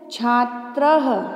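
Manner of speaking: छात्रः drawn out with an audible h ending; final visarga as h. Shown as a demonstration. Teacher showing pupils how to say the word, final visarga as h. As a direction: sound out छात्रः slowly, ending on "ha". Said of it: "ह से किया जाता है".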